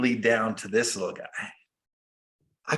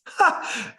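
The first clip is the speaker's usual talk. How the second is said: burst of laughter; 13 semitones higher than usual. Real, not acted. Laughs at being called selfish.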